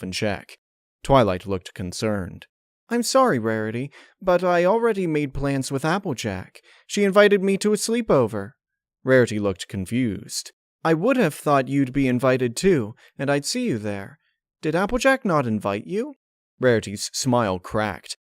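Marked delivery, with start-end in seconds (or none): 2.9-8.5 put-on higher, apologetic young mare; Twilight voice. Twilight turning down Rarity's invitation, higher and apologetic. 10.8-16.1 put-on higher, puzzled young mare; Twilight voice. Twilight asking Rarity about the invitation, higher and puzzled.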